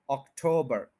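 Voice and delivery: October as ok-TOH-bur, stressed TOH; second-syllable stress. Shown as a demonstration. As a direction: lean on the middle "TOH" of October. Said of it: "second पे stress है". (अक्टूबर के "टो" पर ज़ोर)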